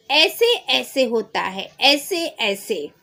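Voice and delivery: ordinary talk, nothing marked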